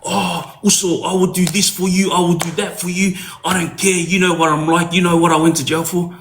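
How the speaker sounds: a hint of Nathaniel promising to help; Nathaniel's pleading. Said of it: "he was telling me things like".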